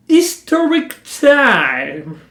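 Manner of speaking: high, loud, very slow English title; grand title announcement. Acted. Announcing a show category to viewers, grandly stretched. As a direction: announce it big and drawn-out.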